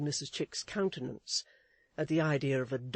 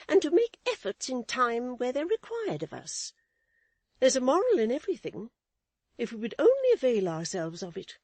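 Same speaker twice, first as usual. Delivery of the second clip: much higher, prim lady's voice; Missus Chick's voice; 9 semitones higher, 7 dB louder than usual. A narrator's reading. Wife lecturing her husband, in a high prim voice. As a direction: preach a lesson, high and proper.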